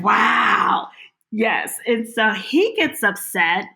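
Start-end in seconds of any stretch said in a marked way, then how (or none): 0.0-0.9 loud startled wow; shocked wow. Retold or imitated. Husband stunned by the bleach threat, loud wow. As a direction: blurt it out in shock. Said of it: "He's like".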